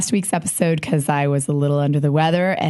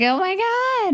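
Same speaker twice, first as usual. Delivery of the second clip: high, squeaky excited squeal; much slower than usual. Retold or imitated. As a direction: squeal it like a starstruck fan. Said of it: "it got a little squeaky".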